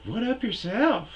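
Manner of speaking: high-pitched imitation of the girl, loud; high girly voice. Comic doing the gas station girl, high and loud.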